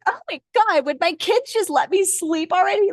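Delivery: high, exasperated mock whine; a tired mom begging for sleep. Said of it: "I'm like".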